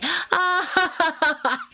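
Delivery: high eerie cackle in the night; mock demonic giggle; creepy laugh. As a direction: cackle like someone possessed. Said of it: "empieza a reírse".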